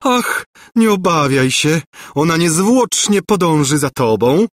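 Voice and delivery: high, sugary little voice; tender cooing. A narrator's reading. Governess reassuring the prince, tender cooing. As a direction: coo it in your tenderest little voice. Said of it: "zagruchała najczulszym głosikiem Gburja Furia".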